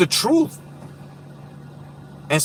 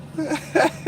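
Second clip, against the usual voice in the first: high laugh; 12 semitones higher, 4 dB quieter than usual. Real, not acted. Laughing at joke.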